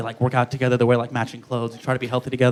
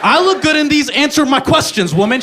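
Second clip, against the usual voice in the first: mock indignant; 7 semitones higher than usual. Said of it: "I'm like".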